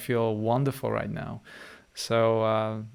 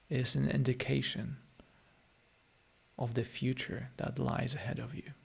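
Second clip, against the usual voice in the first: guided meditation; 9 dB quieter than usual. Acted. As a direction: gently ease the listeners back into their bodies. Leads a closing guided meditation.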